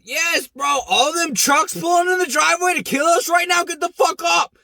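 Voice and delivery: high, loud frantic shouting; panicked yell. Retold or imitated. Warning buddy as trucks pull in, frantic high yell. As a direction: scream at him to run now.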